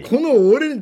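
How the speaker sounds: high, loud comic indignation; mock outrage. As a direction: insist on your own importance in jest. Mock outrage at having to talk.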